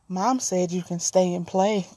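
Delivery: soft, eerie little-twin voice; lightly voiced creepy kid inviting a sleepover; a hint of the creepy Halo twins; creepy child voice. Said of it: "that's the tone of voice it was in".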